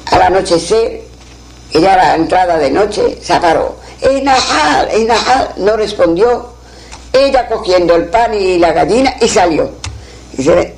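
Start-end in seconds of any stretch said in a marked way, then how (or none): 4.0-5.5 lightly acted call, higher at first. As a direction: call the name out without really shouting. Character calls out the name again. (llamada en voz alta, sin gritar)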